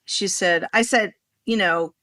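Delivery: usual speaking voice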